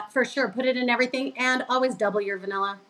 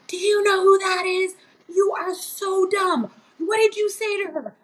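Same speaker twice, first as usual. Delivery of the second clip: mock frantic scolding; 10 semitones higher than usual. Coworker Ray scolding her in the aisle, high frantic mock voice. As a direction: play the coworker freaking out, hammed up. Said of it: "he just went crazy".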